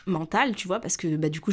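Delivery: plain reading voice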